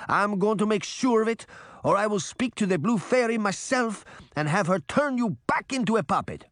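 put-on accented old father's high, emphatic scolding; stern, angry Geppetto. A narrator's reading. Geppetto confronts his son over betraying friends, indignant. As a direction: scold indignantly, punching the key words.

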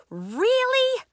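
very high, loud squeal of disbelief; outraged, high-pitched question; incredulous. A narrator's reading. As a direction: shoot up high in exasperation. Nori exasperated at Willa's rain.

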